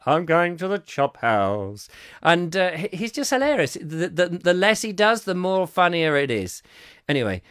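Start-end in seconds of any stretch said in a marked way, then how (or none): 0.1-1.9 put-on slow drawled old comic voice; a lazy 1930s comedian line; W C Fields impression. Host repeats the W C Fields quote, slow drawl. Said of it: "watching some of that".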